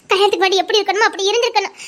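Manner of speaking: ordinary talk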